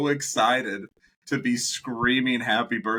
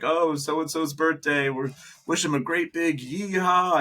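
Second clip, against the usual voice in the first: mock staff announcement; 6 semitones higher than usual. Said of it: "They're like".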